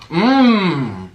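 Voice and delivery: long mmm that swoops down; relishing hum. Real, not acted. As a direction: hum with relish over the bite. Relishing a bite.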